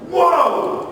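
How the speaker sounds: high, loud amazed whoa; an awed, much bigger whoa. Retold or imitated. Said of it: "Now I'm upset".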